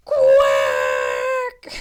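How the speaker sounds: very low, loud quack; duck squawk. Acted. Duck interrupts loudly.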